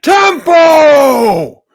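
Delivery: drawn-out high, loud yell; hammed-up slow bellow; shouted movie line. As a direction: roar it like an outraged bandleader, stretching each word. Acting out the film's bandleader.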